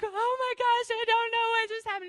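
high, loud, ecstatic retelling; excited squeal. Retold or imitated. Jumping in a circle with the healed girl, excited squeal. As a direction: squeal in giddy disbelief. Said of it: "but really excited".

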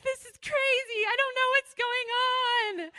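high, loud, ecstatic retelling; excited squeal. Retold or imitated. Jumping in a circle with the healed girl, excited squeal. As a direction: squeal in giddy disbelief. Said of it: "but really excited".

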